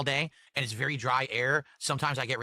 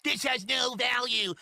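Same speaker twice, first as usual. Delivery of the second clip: slow, much higher mocking voice; mocking imitation; 10 semitones higher, much slower than usual. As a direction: drag it out like a whiny mimic. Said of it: "He was saying".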